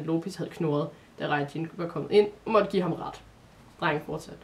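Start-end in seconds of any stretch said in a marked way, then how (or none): none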